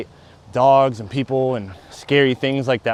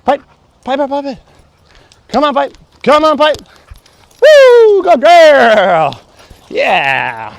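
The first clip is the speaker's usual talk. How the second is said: excited dog praise; 13 semitones higher, 12 dB louder, much slower than usual. Real, not acted.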